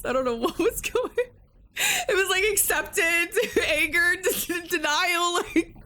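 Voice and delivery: high voice breaking into laughter; giggling and half crying about the emotional rollercoaster; laughing hard. Real, not acted. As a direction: laugh through every word, barely holding it. Laughing over the game swing.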